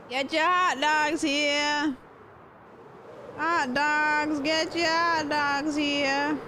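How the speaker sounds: high, drawn-out street vendor's call; hot dog vendor. Pretend. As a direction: hawk hot dogs to a crowd, slowly and high.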